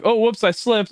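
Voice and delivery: high, fake-innocent oops; mock innocent. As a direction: sell the fake accident with a high voice. Faking accident to victim.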